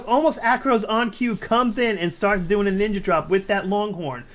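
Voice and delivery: ordinary talk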